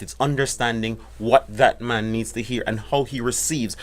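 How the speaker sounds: ordinary talk, nothing marked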